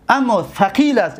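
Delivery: usual speaking voice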